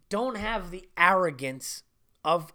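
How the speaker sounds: usual speaking voice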